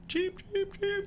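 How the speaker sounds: very high, soft bird chirps; imitating birds protesting after losing their eggs; protesting cheeps. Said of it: "the birds just protesting".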